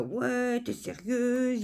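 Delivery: high, drawn-out scornful imitation; mocking rude courier. Customer imitates the courier yelling at her. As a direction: sneer it like the angry courier. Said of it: "Il continue".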